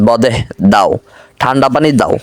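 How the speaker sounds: usual speaking voice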